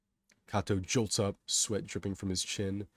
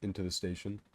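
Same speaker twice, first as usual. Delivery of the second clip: plain reading voice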